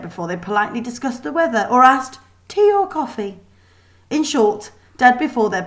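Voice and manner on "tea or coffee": high, dainty mock-polite question; a mocking hint of a prim hostess; prim girl. A narrator's reading. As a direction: mimic a prissy young lady. Mocking prim girls' manners.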